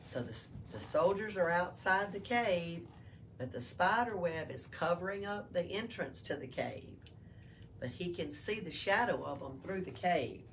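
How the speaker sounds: ordinary delivery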